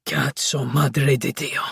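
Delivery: deep slow Italian growl; low growl. Acted. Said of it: "he growled".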